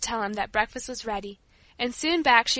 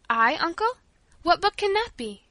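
high, puzzled lift; surprised question; 6 semitones higher than usual. A narrator's reading. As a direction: ask in innocent bewilderment. Paula baffled by uncle.

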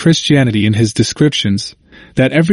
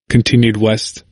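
plain reading voice

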